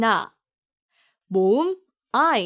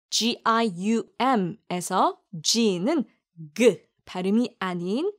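ordinary delivery

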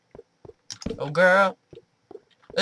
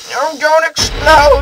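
panicked plea; 11 semitones higher, 12 dB louder, slower than usual. Real, not acted. Pleading with creeper not to explode.

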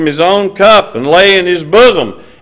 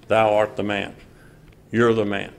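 much softer, low and steady; calm accusation; 6 semitones lower, 10 dB quieter than usual. Acted. Nathan calmly telling David that he is the guilty man, softly. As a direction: lay it on him quietly, no heat. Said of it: "apparently very calmly said".

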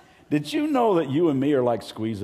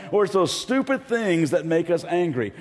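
usual speaking voice

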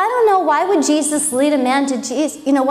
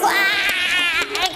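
high imitated wail; mock crazy yell; 6 semitones higher than usual. Demoniac comes running at the boat, mock crazy yell. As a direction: let out a high mock-crazed cry.

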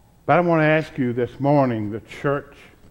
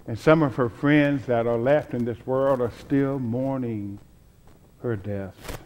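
softer, lower, tender grief; subdued remembrance; 3 semitones lower, 6 dB quieter than usual. Real, not acted. Preacher recalling his late mom to the congregation, subdued. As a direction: let it sink quieter and lower, gently mourning. Recalls mother's recent death.

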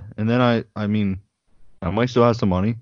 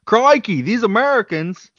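high, loud mock Australian exasperation; 10 semitones higher, 5 dB louder than usual. Imagining Australian listeners, loud mock Australian accent. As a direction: lampoon Australian listeners groaning at Americans. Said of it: "They might say".

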